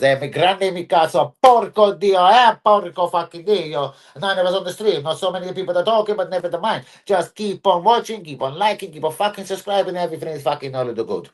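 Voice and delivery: hammed-up Italian accent, pitched high; fake Italian accent. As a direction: put on a mock Italian accent for emphatic cursing. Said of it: "That's the Italian accent".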